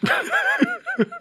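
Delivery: high falling ojou-sama laugh; an airy, high-society ho-ho laugh; haughty rich girl laugh. Acted. High laugh after mock rich-buyer view.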